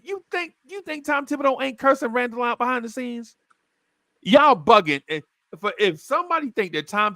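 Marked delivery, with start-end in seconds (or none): 0.0-5.0 scoffing. Retold or imitated. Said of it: "he said".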